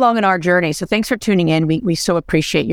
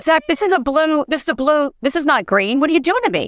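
higher pitched mock alarm; mock panic; 7 semitones higher than usual. Host retells alarm to son on ski slope, higher mock panic. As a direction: retell the panic at the blue run. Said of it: "I'm like".

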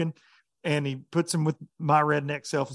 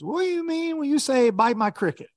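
put-on much higher, puzzled foreign accent; imitated Japanese accent; 10 semitones higher than usual. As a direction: ask it like a confused newcomer. Japanese angler voiced, asking.

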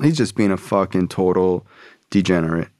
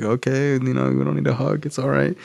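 higher-pitched, placating dad; uneasy politeness; 5 semitones higher than usual. Retold or imitated. Dad declining a friend's hug, awkwardly polite. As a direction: politely fend someone off, acted out.

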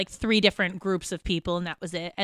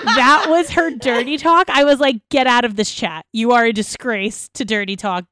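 loud mock scolding; 2 semitones higher, 7 dB louder than usual. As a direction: tear into her with laughing mock disgust. Mock-scolding friend, laughing loudly.

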